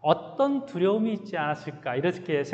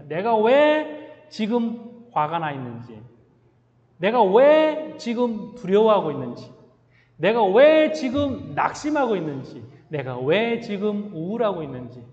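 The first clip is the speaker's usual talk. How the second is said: 왜 hit hard, louder and higher, building; preaching climax; 4 semitones higher, 5 dB louder than usual. Real, not acted. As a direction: let 내가 왜 지금 ring out loud from the pulpit. Preaching climax, rhetorical series.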